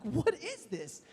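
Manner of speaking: high, soft, laughing incredulity; baffled disbelief. Retold or imitated. Nervous customer reading the waiver, baffled disbelief. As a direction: squeak it out in mock disbelief.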